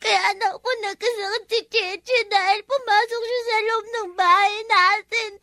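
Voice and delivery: put-on child sobbing through an account; words broken up by sobs; tearful explaining. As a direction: report it to mother while crying. Crying child reports the accusation.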